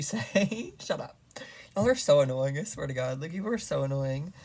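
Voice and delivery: normal talking voice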